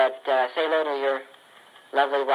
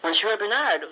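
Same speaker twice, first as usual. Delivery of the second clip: ordinary delivery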